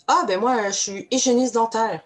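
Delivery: proud job answer. Retold or imitated. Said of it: "on va demander aux gens".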